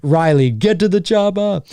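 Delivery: mock Arnold shouting an order; lightly voiced Arnold; Arnold catchphrase. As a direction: toss off a quick Arnold line.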